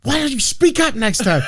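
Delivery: high, exasperated imitation of an annoyed wife; mock snapping. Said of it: "never snaps".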